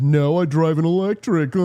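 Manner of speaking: loud caricature with goofy laugh; hammed-up jeering retort; mocking young man. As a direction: imitate a smug kid, then guffaw. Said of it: "And he said".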